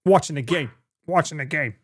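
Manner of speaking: lightly imitated Chicago accent. Streamer copying the lesson video, Chicago accent.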